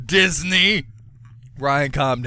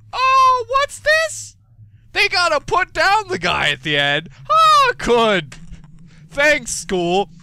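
sarcastic mock surprise; 10 semitones higher, 4 dB louder, slower than usual. Real, not acted. Sarcastic reaction to sad book.

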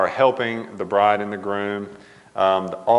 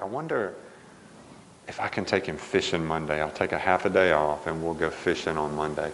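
quiet musing; 4 semitones lower, 8 dB quieter than usual. Retold or imitated. Musing quietly to wife Katie.

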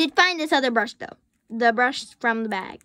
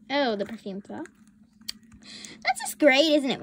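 normal talking voice

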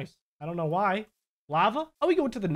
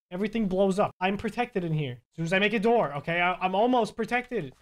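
ordinary talk

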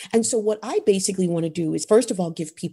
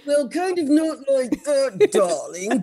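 mock-posh darling voice, higher; talking through the teeth; 6 semitones higher than usual. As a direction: tease with a hammed-up grand voice. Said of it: "talk through your teeth or something".

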